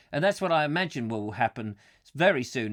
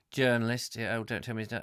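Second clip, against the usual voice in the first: usual speaking voice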